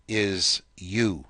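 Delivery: ordinary talk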